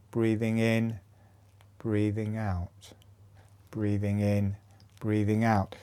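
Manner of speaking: soft, measured breathing guidance; softly pace each breath in and out; breathing cue. Shown as a demonstration. Paces breathing with foot movement.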